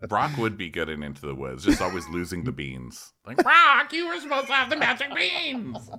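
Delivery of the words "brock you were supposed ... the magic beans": very high screech opening loud, then laughing; hammed-up fairy-tale scolding; scolding stage mother. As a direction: squeal it in mock outrage. Scolding Brock in Into the Woods.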